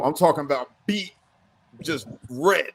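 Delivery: ordinary talk, nothing marked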